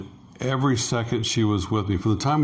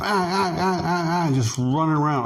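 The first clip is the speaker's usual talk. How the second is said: mimicked hyper kid noises; 7 semitones higher than usual. Kid zipping around nonstop.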